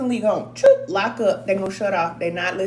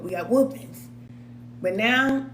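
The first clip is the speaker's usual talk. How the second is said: ordinary delivery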